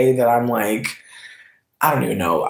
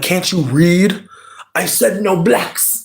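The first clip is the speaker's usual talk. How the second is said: mocking rude man; 5 semitones higher, slower than usual. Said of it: "he really popped up on me like".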